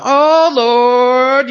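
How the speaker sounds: put-on elderly cry to the Lord. Roommate quoted again.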